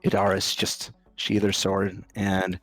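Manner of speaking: usual speaking voice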